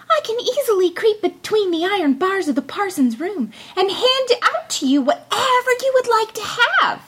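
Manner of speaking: high, small scheming voice; tiny Tom Thumb. A narrator's reading. Tom Thumb explains his plan to the thieves, high and sly.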